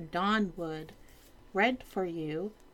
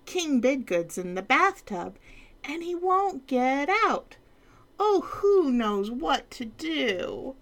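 higher fretting page voice; fretful page; 8 semitones higher, 3 dB louder than usual. Acted. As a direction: wring hands as the page. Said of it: "cried the page".

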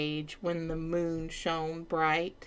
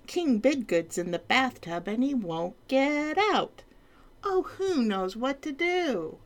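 higher fretting page voice; fretful page; 7 semitones higher than usual. Acted. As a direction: wring hands as the page. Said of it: "cried the page".

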